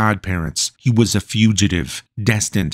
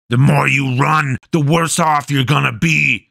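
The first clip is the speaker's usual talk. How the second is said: shouted threat; 5 semitones higher than usual. Acted. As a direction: yell after him as you chase.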